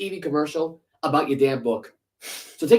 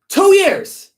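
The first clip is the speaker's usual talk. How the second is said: jumps way higher and louder on two years; mocking disbelief; 9 dB louder than usual. Real, not acted. Host mocking coaches' short tenures, high incredulous squeak.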